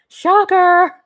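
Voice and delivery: loud, high mock exclamation; mock exclaim. Real, not acted. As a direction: belt it out like a big reveal. Exclaiming to viewers.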